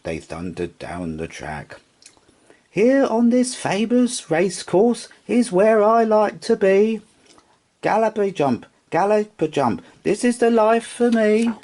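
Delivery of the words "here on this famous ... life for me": high, happy little rocking horse voice; a contented toy horse, sung out high; Sugarlump's high voice. Acted. Rocking horse content in the bedroom, high and happy.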